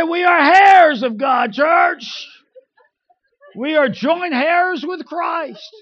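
loud, high pulpit proclamation, drawn out; preaching pastor. Retold or imitated. Preacher mimicking the pastor addressing the church, loud proclaiming.

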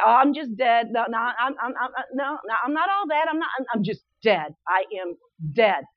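low, soft, lifeless mumble; mock listless believer. Mimicking old church members, a low lifeless mumble. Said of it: "we would talk about how we're dead".

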